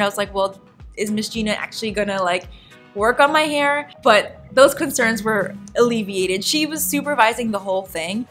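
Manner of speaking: usual speaking voice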